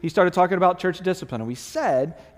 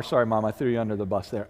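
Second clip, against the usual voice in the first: ordinary delivery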